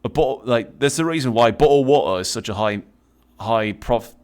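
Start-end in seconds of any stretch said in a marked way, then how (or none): 1.5-2.2 "bottled water" with the T's swallowed; British glottal stop in "bottled water"; dropped T. Shown as a demonstration. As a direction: say "bottled water" with a catch in place of each T. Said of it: "don't put the letter T in water".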